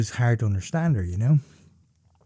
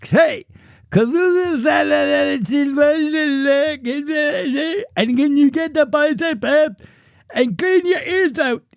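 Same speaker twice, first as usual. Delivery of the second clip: high garbled mock deaf voice; deaf accent; 12 semitones higher than usual. Cashier repeating herself to Dave, high garbled mimicry. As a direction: pitch way up and blur the words. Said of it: "she was like".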